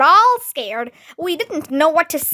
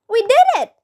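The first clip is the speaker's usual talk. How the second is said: higher, louder cheer of triumph; excited shout; 9 semitones higher, 5 dB louder, much faster than usual. A narrator's reading. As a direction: cheer as if you just won. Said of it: "Emily shouted excitedly".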